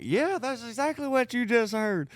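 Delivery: very high, defiant phone comeback; mock angry retort. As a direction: imitate a riled-up man yelling down the line. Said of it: "he's like".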